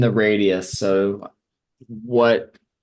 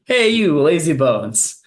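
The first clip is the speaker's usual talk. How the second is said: high, raised-voice mock summons; comic boss yelling at minions; playful yell; 6 semitones higher, 4 dB louder than usual. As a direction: holler for lazy underlings to come over. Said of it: "yelling at s".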